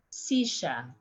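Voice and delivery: Sisha, silla with a sh; sh sound. Shown as a demonstration. Teacher demos chair with sh. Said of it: "pronounce the three different ways".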